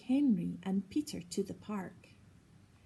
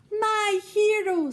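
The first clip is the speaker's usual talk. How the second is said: fond praise; 12 semitones higher, 9 dB louder than usual. A narrator's reading. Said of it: "said Mum".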